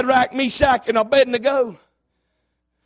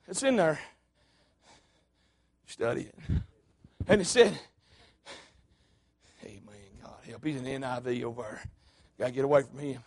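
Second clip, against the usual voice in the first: much softer, dropped away from the mic; a muttered joke to someone nearby; off-mic aside; 12 semitones lower, 11 dB quieter than usual. Real, not acted. Preacher joking off mic about a member's Bible translation.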